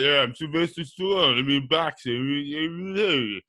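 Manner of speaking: mumbled, drawn-out action-star impression; mostly sound, words melting into mush; Sylvester Stallone. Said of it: "It's just mostly sound".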